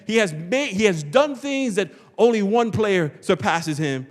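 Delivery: normal talking voice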